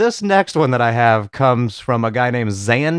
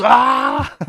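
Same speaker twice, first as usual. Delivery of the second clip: high mock roar of Ah; mock yell; 12 semitones higher, 4 dB louder than usual. Imitating the wrestler dousing himself, hammed-up yell. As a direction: yell like water hit your head. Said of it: "pours it over his head and just goes".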